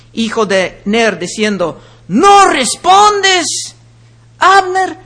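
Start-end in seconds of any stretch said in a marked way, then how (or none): 2.1-5.0 slow, high, loud call stretched across a distance; long drawn-out call. Shown as a demonstration. David calling across to Abner from the hilltop, long slow call. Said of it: "Empieza así, como que estaba ahí mucho tiempo".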